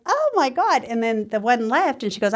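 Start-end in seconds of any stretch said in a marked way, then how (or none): 0.1-0.8 high surprised oh my God; retold shock, pitched up; shocked gasp. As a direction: burst out in surprise. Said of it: "And I was like".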